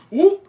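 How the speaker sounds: loud, high whoop for a car's lurch; drawn-out jolted whoop; imitated whoop. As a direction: whoop as if hitting a pothole. Imitates car hitting a pothole.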